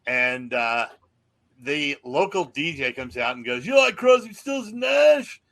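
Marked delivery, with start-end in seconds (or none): 3.7-5.4 DJ hype. Retold or imitated. Local DJ whipping up the concert crowd, hyped announcer call. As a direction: stretch it out like a radio DJ revving up a crowd.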